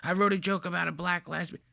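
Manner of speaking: soft, far-off imitation of the smug comic; mocking the comedian. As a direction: mimic his giddy confession. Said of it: "He told her this joke looking like this".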